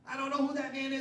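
high, loud hammed-up denial; mock angry denial. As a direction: fling the denial like a man caught out. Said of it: "swearing like a drunken sailor".

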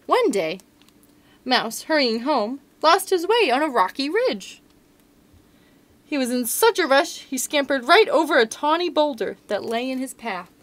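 put-on lively read-aloud to children; storybook narration. A narrator's reading. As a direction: tell it like a picture book. Reading picture book to children.

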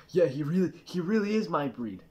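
put-on dim-witted dog voice; mock dog voice. A fooled Labrador talking about another dog, mock dog voice. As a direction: play the fooled dog lightly. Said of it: "That dog's gonna be like".

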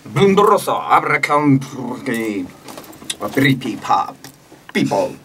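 fake Ugandan language. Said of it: "それっぽいウガンダ語で".

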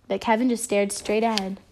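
ordinary delivery

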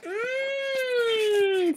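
high, drawn-out yummy hum; delighted mmm. Acted. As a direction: savor the beans. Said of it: "said Camilla".

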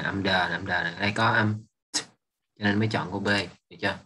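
ordinary talk

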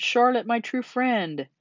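high, slow, drawn-out lament; anguished moan. A narrator's reading. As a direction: moan it slowly to a dear friend. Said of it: "he moaned".

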